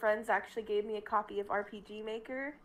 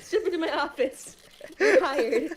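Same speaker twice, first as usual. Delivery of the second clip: loud, high play-acted boss; big playful boss announcement; mock boss; 7 semitones higher, 7 dB louder than usual. Joking about hiring a book, loud mock boss voice.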